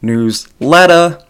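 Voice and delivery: newsletter said as newslettah, Boston style; drawn-out Boston newslettah with no final R; Boston accent. Shown as a demonstration. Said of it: "like, Boston, it's newsletter".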